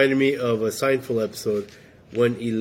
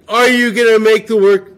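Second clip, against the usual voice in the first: mock yelling email; 9 semitones higher, 10 dB louder than usual. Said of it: "To be yelling all the time?".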